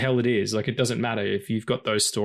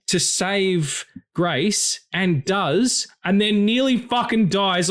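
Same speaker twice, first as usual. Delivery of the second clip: outraged disbelief; 8 semitones higher, slower than usual. Retold or imitated. Actor replaying his protest to a castmate, high indignant rant. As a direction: relive your own indignant outburst at the ending. Retelling own outburst to castmate.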